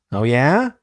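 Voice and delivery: mildly eager rising question; understated acted eagerness, lifting at the end; enthusiastic tone. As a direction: read it with a touch of enthusiasm. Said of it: "enthusiastic tone in voice".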